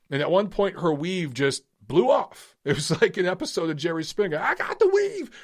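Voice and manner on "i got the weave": mock high voice. Mocking the weave scene.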